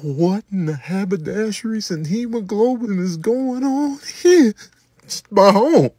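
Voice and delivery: normal talking voice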